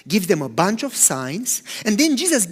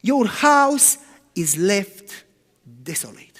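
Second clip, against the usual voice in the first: very slow, drawn-out judgment; solemn pronouncement; slower than usual. Retold or imitated. Preacher voicing Jesus's verdict on the temple, slow and solemn. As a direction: let each word fall slowly and heavily.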